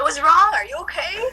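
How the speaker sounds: high, loud, startled questions; mock panic, asking if they're okay; alarmed. Acupuncturist retelling a crying patient, mock alarmed. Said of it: "I'm, like, freaking out too".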